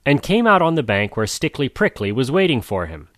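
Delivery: ordinary delivery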